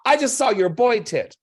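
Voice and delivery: put-on shrieking little boy; kid's triumphant scream. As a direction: shriek it like a delighted four-year-old. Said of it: "The kid screams".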